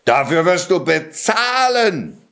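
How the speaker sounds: high, threatening outburst; raging ogre. A narrator's reading. Ogre threatening the intruding cat, raging. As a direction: vow revenge in a high rage. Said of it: "tobte der Oger".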